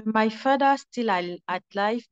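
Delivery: usual speaking voice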